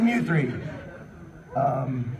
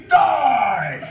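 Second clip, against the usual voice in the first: higher, louder villain shout; villain yell; 9 semitones higher, 5 dB louder than usual. Acted. Salamence threatens the heroes, yelling. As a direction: scream the threat like a cartoon villain.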